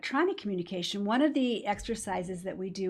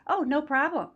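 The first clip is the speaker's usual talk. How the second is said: higher, louder casual reply; breezy brush-off; 6 semitones higher, 5 dB louder than usual. Retold or imitated. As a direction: imitate a breezy, offhand staff answer. Staff's casual reply, acted.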